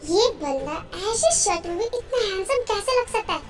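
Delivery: soft, wondering inner voice; hushed aside to oneself; private thought. Acted. Character's private thought while looking.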